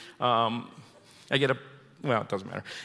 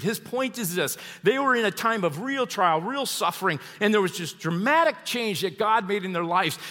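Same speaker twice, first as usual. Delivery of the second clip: usual speaking voice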